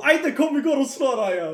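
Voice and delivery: rally him to go fight, laughing; eager mock rally. Mocking eager fight call. (ivrigt skrattande uppmaning att slåss)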